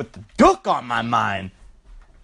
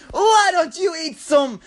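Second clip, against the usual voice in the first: high-pitched mock-furious Australian mom; lashing out; 11 semitones higher than usual. Exhausted mom lashes out at her pestering kid, hammed-up anger. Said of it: "she just lashes out".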